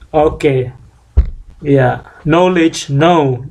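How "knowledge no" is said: knowledge said "no-ledge", k silent, slow; correct pronunciation. Shown as a demonstration. Teacher contrasting no with keno for students. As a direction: begin knowledge with "no", not "keno". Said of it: "Jadi bukan keno".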